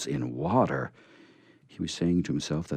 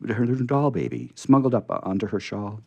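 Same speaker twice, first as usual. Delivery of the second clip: ordinary delivery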